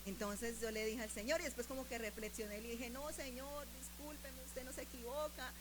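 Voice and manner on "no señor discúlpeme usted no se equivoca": soft, contrite little prayer; meek retold apology. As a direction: back down quietly before God.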